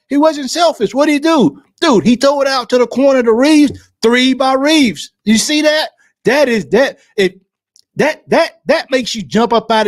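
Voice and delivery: near shouting with joy at the play; real excitement. Real, not acted. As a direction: fan losing it over a great three. Thrilled by a three-pointer.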